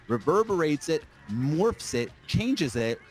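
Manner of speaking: ordinary delivery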